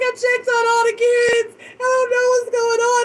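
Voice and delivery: high, upset retold plea; worked-up, tearful-style insistence; distressed protest. Retelling her distress while crying.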